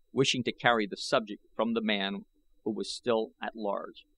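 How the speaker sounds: ordinary delivery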